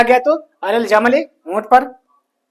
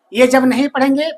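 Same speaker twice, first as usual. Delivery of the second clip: usual speaking voice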